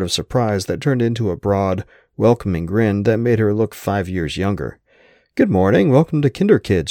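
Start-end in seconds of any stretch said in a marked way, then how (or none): 5.3-6.9 high, lightly Irish lilting greeting; receptionist's singsong welcome with a hint of Irish accent. A narrator's reading. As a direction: greet them in a musical, higher-pitched Irish lilt. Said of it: "she said in a lilting accent".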